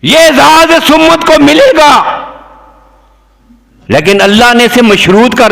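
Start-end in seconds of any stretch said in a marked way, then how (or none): 0.0-2.2 high, slow, drawn-out declamation; preaching proclamation. Real, not acted. Preacher proclaiming the community's honour, declaiming slowly. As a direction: stretch it out on a high pulpit pitch.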